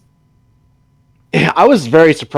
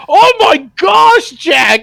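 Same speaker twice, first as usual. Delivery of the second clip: high, loud, drawn-out gasp of shock; mock cricket shout, much higher; 6 dB louder, slower than usual. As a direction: cry out in horror like the cricket.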